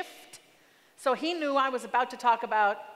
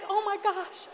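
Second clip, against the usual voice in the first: thrilled; 9 semitones higher than usual. Retold or imitated. Son finally calling her.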